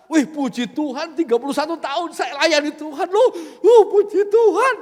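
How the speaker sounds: loud, laughing show-off praise; mock pious bragging, laughing; smug boast. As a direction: brag about years of service with a laugh. Mocking a boastful churchgoer.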